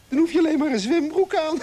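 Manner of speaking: high, loud line breaking into laughter; laughing excitement. Acted. Moffel explaining hot weather to Piertje, laughing. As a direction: crack yourself up while explaining. Joking about swimming trunks only.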